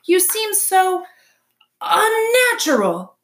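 high, slow, drawn-out screech. A narrator's reading. Owl rejects the robot from the treetop, screeching. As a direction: squawk it with loud, stretched disgust. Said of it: "screeched the owl from the very top".

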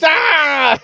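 high frustrated cry breaking into a laugh; exasperated yell. Real, not acted. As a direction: yell out in disbelief, then laugh. Order fails, sent back again.

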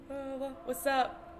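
ordinary talk, nothing marked

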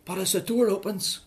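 ordinary delivery

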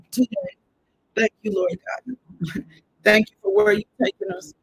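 ordinary talk

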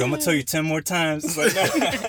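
laughing loudly while teasing. Real, not acted.

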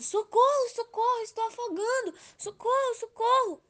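high, loud cries of socorro; calling for help. A narrator's reading. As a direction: shout desperately for help as if drowning. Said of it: "o amor começou a pedir ajuda".

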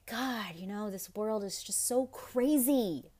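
a touch of frustrated venting, drawn out. Retold or imitated. Voicing their own thoughts when feeling frustrated, mildly exasperated. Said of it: "when I am feeling frustrated".

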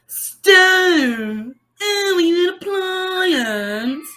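loud, high, long-held wailing vowels; nutcase outburst. Retold or imitated. Re-enacting nonsense at the intercom camera, nutcase wail. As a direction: draw it out absurdly, high and silly. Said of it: "in my Tourette's mind, start going".

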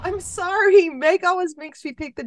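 high and loud as Jo; playing Jo, loud. Guest's turn at Jo's line, loud and high.

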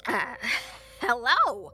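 loud, nervous blacksmith voice; nervous greeting. A narrator's reading. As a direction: call out uneasily to the stranger. Nervous greeting to hooded stranger.

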